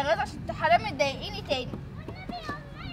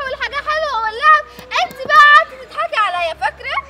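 high, loud accusing outburst; vengeful accusation; 5 semitones higher, 8 dB louder than usual. Acted. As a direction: throw the grievance back at your sister, loudly. Sister taking revenge, recalls being mocked. (عتاب غاضب بصوت عالي)

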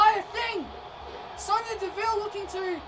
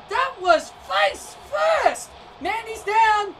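slow, louder stretched-out yell; excited shouting; 3 semitones higher, 4 dB louder, slower than usual. Real, not acted. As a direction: drag out the shock, loud. Commentator yells at brutal spot.

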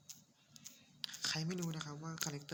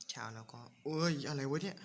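louder startled yelp; 7 dB louder than usual. Real, not acted. Gamer startled by a monster in the water, yelping. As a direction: jump at the sudden monster.

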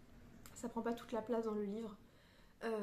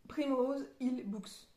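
Primrose Hill Books said slowly in an English accent; careful English accent; 3 dB louder, much slower than usual. Shown as a demonstration. Vlogger reads the bookshop name aloud, slow English accent. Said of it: "Désolée pour mon accent".

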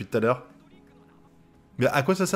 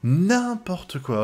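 slow, high-pitched, drawn-out disbelief; incredulous protest; 7 semitones higher, much slower than usual. Real, not acted. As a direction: stretch the words out in dismay. Indignée par le résultat. (incrédulité indignée, mots étirés dans l'aigu)

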